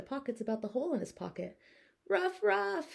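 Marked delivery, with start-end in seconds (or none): 2.1-2.9 high yappy ruff ruff; little dog barks. Acted. Pocket dogs greeting their owner with high barks. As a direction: bark it like tiny dogs. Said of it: "he said".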